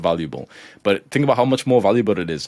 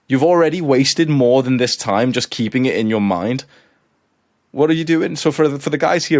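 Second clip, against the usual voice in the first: higher and fired up, scolding; heated rant; 6 semitones higher, 4 dB louder than usual. Real, not acted. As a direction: shake them out of hesitation. Ranting at hesitant viewers.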